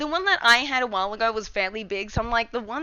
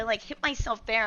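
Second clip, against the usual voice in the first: ordinary talk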